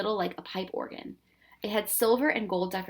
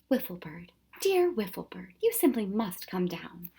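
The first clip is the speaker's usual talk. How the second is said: firm but soothing. Acted. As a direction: coax her down gently. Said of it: "in a firm but soothing voice".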